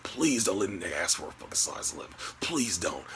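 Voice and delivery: soft, low, hurried plea; pleading under breath. Retold or imitated. Customer watching rivals walk up, hushed pleading. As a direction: beg quietly, as if to yourself. Silently begging while watching the line.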